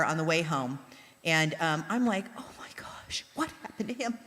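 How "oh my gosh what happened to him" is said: hushed, horrified whisper; inner-thought whisper. Retold or imitated. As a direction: whisper it like a private worried thought. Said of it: "That's what I'm thinking in my head".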